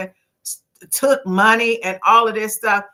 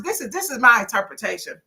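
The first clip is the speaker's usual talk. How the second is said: usual speaking voice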